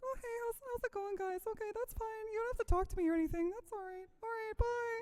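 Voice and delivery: high, timid voice; nervous shy voice. Shown as a demonstration. Showing her dumb nervous and shy character, timid. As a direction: shrink away, shy and awkward. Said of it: "I'm nervous and shy".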